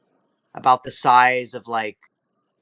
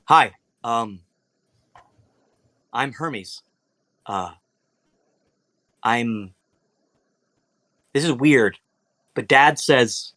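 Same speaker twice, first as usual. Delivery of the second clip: halting, with long uneasy pauses; unsure newcomer, trailing between phrases; awkward, hesitant introduction. Acted. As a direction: stumble sheepishly through an embarrassing announcement. Shy god introduces himself awkwardly.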